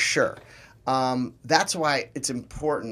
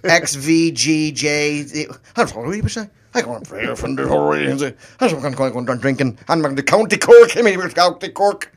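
hammed-up Irish accent, louder; 7 dB louder than usual. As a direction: belt it out in a thick County Cork Irish accent. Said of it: "Do you do the Irish one".

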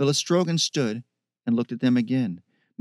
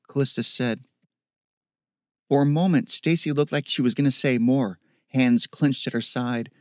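usual speaking voice

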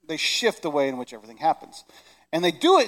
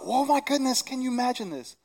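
put-on excited kid; 7 semitones higher, 3 dB quieter than usual. Excited kids voiced in sermon.

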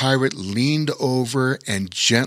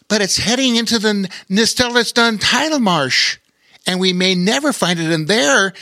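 higher, quick anxious sailor voice; worried Sailor Danny; 10 semitones higher, 3 dB louder than usual. A narrator's reading. Sailor Danny warning the package is heading into the marsh, quick and higher. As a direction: rush it out, worried.

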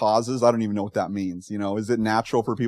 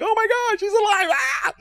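much higher, squealing mock-panicked cry; 4 dB louder than usual. Pastor imitating bystanders fleeing the coffin in mock panic. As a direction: act out bystanders freaking out at a corpse.

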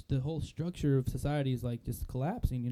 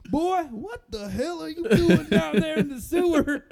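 hillbilly; 10 dB louder, slower than usual. Retold or imitated. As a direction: holler down the hole like a hillbilly. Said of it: "hillbilly dude was, like, filming him".

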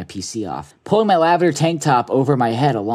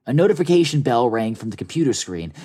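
ordinary talk, nothing marked

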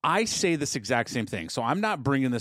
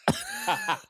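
sudden high shriek of laughter; loud shrieking laugh; 3 dB quieter than usual. Real, not acted. Husband cracking up at serp, shrieking laugh. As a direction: burst out laughing. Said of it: "I do not do that on purpose".

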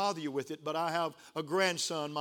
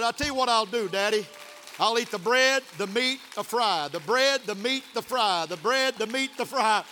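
loud, higher, rhythmic listing; excited retold child; 6 semitones higher, 7 dB louder than usual. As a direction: punch each food in a repeating beat, imitating an eager boy. Grandson's triumphant plan, preached rhythmically.

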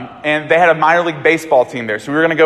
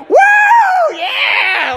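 high, drawn-out victory whoop; happy cheering; 4 dB louder than usual. Retold or imitated. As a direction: cheer as if you just won the ball. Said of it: "so happy that he got this ball".

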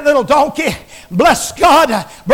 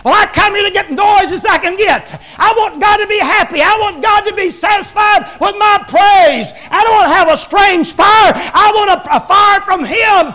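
shouted worshipper; 6 semitones higher than usual. Retold or imitated. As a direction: proclaim it like a fired-up believer. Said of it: "That's what a lot of 'em think".

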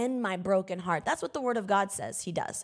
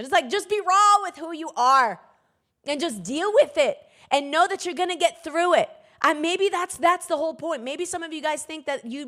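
preaching; 7 semitones higher, 6 dB louder than usual. Acted. Preaching climax.

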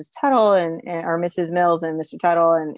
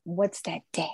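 confused whisper; 9 dB quieter than usual. Retold or imitated. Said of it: "you're like".

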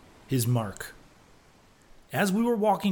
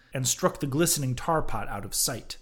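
ordinary delivery, nothing marked

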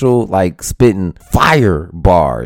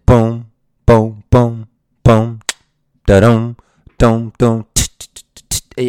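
beatboxed rap beat. Shown as a demonstration. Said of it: "Iverson has a rap beat".

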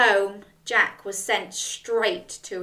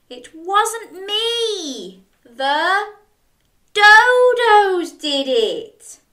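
high, very slow whiny protest growing louder; Jack wailing; 9 semitones higher, 7 dB louder, much slower than usual. Acted. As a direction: wail it like a blamed child. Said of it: "not everybody enjoyed".

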